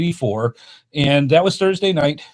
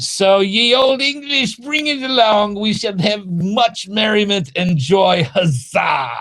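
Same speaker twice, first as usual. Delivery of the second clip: mock old English; 7 semitones higher than usual. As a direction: proclaim like a jolly Elizabethan crier. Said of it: "that's what you do with old Elizabethan".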